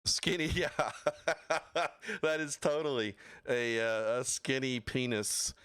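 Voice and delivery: cracking up mid-sentence, pitched high; real burst of high laughter; laughing. Real, not acted. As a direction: laugh your way through the words. Cracks up at a chat comment.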